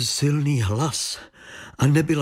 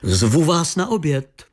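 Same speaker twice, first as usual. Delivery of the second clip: higher, lilting called invitation; calling out; 6 semitones higher than usual. A narrator's reading. Lady at the window invites the detective to lunch, calling out. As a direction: call the invitation across to a guest. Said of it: "zavolala paní Věra".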